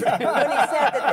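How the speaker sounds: high, loud laughing outburst; loud laughter. Real, not acted. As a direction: burst out laughing loudly. Bursts out laughing.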